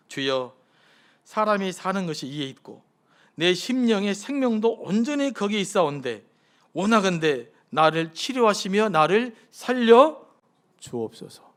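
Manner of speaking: slow, swelling prayer read, last words fading; solemn scripture reading. Acted. Pastor reading Hezekiah's prayer to the congregation, slow and solemn. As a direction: read the prayer slowly, building to a plea. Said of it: "제가 읽어드리겠습니다".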